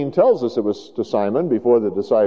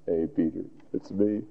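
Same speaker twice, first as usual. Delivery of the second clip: very soft, low, tender greeting; gentle reassurance; 6 semitones lower, 11 dB quieter than usual. Acted. Jesus coming to the sorrowful Peter, softly and tenderly. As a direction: reach out gently, almost hushed.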